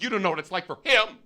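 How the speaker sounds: high, fast indignant outburst; imitated yelling. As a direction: rattle off an angry accusation in mock outrage. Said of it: "She kept yelling at me".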